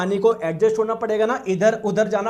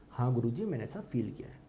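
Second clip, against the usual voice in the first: student's reply; 8 semitones lower, 11 dB quieter than usual. Acted. Teacher voices a student's reply.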